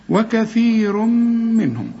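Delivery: وكثير منهم slowly, the nun merged into a held م; slow recitation with the merging; merged nun into mim. Shown as a demonstration. Teacher recites the phrase for the student. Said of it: "كز على الشفايف شوية".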